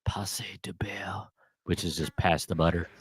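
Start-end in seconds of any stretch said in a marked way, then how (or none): 0.1-1.3 hushed, slow sultry French; sultry near-whisper in French. Retold or imitated. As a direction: draw it out softly, like a whispered seduction. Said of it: "in this sultry voice".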